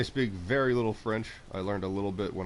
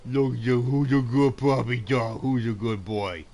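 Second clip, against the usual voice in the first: slow, higher sing-song praise for a puppy; 4 semitones higher, 6 dB louder, slower than usual. Real, not acted. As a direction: coo at the good dog, drawn out. Petting the dog in game.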